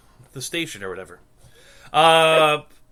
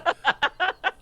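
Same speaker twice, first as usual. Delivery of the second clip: high squealing laugh; 4 dB louder than usual. Real, not acted. Cracking up at own joke, high laugh. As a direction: burst into a high giggle.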